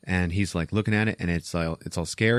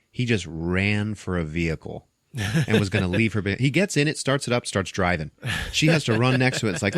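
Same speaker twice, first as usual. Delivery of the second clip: normal talking voice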